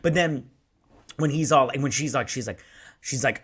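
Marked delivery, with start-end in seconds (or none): none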